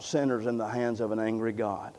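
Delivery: low, soft title read with no inflection; monotone reading. Shown as a demonstration. Preacher mimicking Edwards reading his sermon title, monotone. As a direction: drone the title evenly. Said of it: "read just like this".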